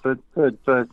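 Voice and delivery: flustered protest. Retold or imitated. Artist objecting to label boss's verdict, flustered. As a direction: cut in with a retold protest.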